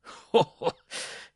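loud, high burst of mocking laughter; roaring laugh. A narrator's reading. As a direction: roar with scornful laughter. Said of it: "in a roar of laughter".

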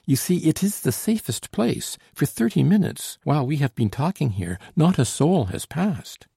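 high-pitched, eager woman character; lifted, lively voice of a persuading heroine; animated Solange. Acted. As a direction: insist brightly in a high character voice. Narrator voices Solange explaining eagerly.

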